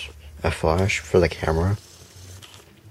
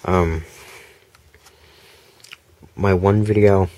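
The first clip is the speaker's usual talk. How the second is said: ordinary talk, nothing marked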